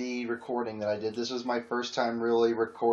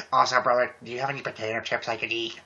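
usual speaking voice

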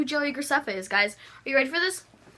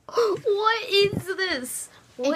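shocked; 7 semitones higher, much slower than usual. Real, not acted. Shocked at his hair.

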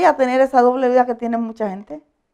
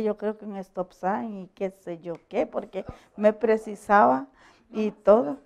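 ordinary talk, nothing marked

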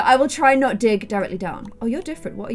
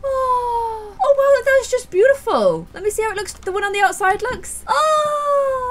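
high, awed little cries; 13 semitones higher than usual. Real, not acted. Gamer admiring her lit house, high awed cries. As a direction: gasp at the beauty.

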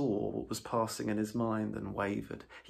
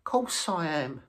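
loyal Mole; 6 semitones higher, 3 dB louder, slower than usual. A narrator's reading. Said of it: "said the Mole loyally".

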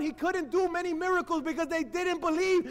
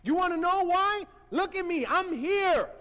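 a hint of a proud angel, lightly raised; 4 semitones higher than usual. Angel to Zechariah, mildly proud and raised. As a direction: angel presenting himself with a touch of swagger. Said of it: "It's like saying".

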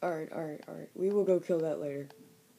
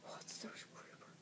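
unvoiced, much softer whisper; 18 dB quieter than usual. Real, not acted. Gamer spotting a creeper in the dark: whispered. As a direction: hush it so the creeper won't hear.

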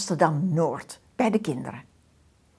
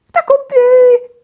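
excited; 15 dB louder than usual. A narrator's reading. Announcing video.

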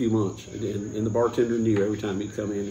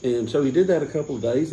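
ordinary talk

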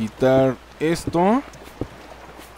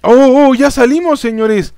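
high, loud cheer of relief; excited triumph; 9 semitones higher, 7 dB louder than usual. Real, not acted. Player telling viewers they finally got out, excited cheering.

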